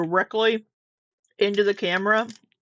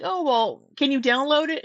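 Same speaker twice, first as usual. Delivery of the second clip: higher-pitched, lightly mimicked coaxing; imitated persistent stranger; 7 semitones higher than usual. Retelling a stranger pressing for a download, lightly mimicked. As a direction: mimic her pushing the request. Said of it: "she's like".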